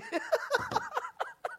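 high-pitched laughing burst. Real, not acted. Guest joking with host about a broken elevator, laughing.

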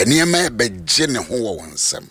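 normal talking voice

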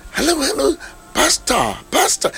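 high, eager hello pastor; excited greeting. Retold or imitated. A woman greeting the pastor, eager high call. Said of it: "obi yɛ".

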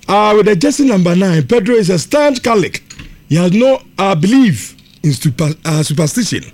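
brisk on-air read of listener messages; on-air sponsor and message read; radio message read. Acted.

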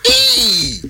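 loud, high "hey" cry; exclamation. Real, not acted. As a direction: let out a long, high, falling whoop. Exclaims amid joking and laughter.

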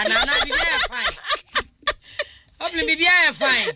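talking through real laughter, pitch jumping high; words bubbling out between laughs, fast; laughing through words. Real, not acted. Host cracks up mid-comment.

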